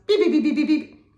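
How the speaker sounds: rapid high, loud beeping; imitated redial beeps. Hitting redial on the office fax phone, imitated beeps. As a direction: beep like a phone dialling out.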